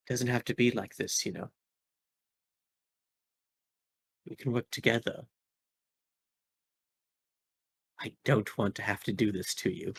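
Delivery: sultry villainess murmuring an offer; soft seductive villain. Acted. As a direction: lure them in gently. Red Recluse seduces the player.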